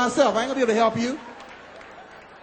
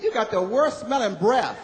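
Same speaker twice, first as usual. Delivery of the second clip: put-on girl going off; 4 semitones higher, slower than usual. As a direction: tell him off, disgusted. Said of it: "She just went off on me".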